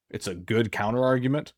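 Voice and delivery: ordinary talk, nothing marked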